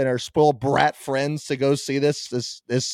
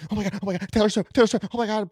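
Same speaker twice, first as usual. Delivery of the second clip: mock fan squeal; 5 semitones higher, 3 dB quieter, faster than usual. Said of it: "The Swifties".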